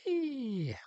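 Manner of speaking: high whispered whee; a breathy, gleeful ride whoop; playful whee. Pretend. Riding the train downhill.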